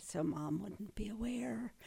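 hushed, conspiratorial, unvoiced; secret whisper. Sister telling an interviewer about hiding a prank from Mom, whispered.

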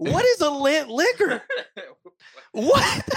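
high, laughing bewilderment; hammed-up baffled offence; imagined shocked people. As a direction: ask it in mock disbelief. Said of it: "people would be like".

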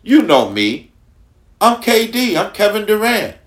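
imitating Kevin Durant. Host retelling Kevin Durant's viral interview boast, light imitation. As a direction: play back his cocky line, half laughing. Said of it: "He said".

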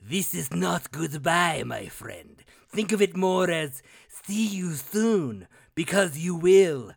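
slow, menacing villain drawl, stretching "see me soon"; Red Skull villain. Acted. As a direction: play it as a gloating supervillain, savouring each word. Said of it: "it does have some fucking Red Skull about it".

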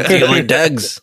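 put-on Cockney, vowels twisted; mock Cockney Klingon voice; Cockney accent. Imagined Klingon asking about dogs, in broad Cockney. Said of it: "if they were all Cockney".

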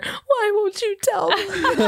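high, slow, teary pretend whine; mock whimpering plea. Interrogator mocking a crying prisoner, high slow whine.

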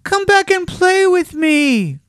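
high, loud, drawn-out rabbit plea; pleading far-carrying cry; calling out. A narrator's reading. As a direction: call after them slowly in a high small voice. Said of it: "called the little rabbit".

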